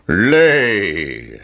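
booming giant command; giant's shout. Acted. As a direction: bark the order like a giant. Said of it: "shouted the giant".

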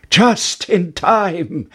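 slow, high relieved cry. Acted. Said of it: "cried Jack".

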